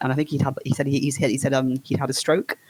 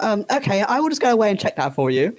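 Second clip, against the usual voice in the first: higher, sweet customer-service voice; be extra nice to the caller; overly polite reply; 6 semitones higher than usual. Retold or imitated. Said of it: "I was like".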